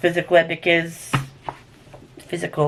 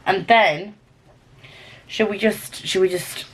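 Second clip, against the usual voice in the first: ordinary talk, nothing marked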